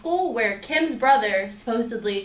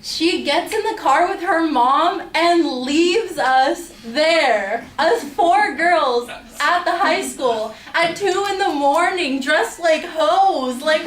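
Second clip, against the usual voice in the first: higher, louder and slow, spelling out the outrage; indignant disbelief; 5 semitones higher, 4 dB louder, slower than usual. Real, not acted. Telling viewers how Kim left them, in higher, louder, slow outrage. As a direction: still fuming as she tells her friends. Outraged retelling of being abandoned.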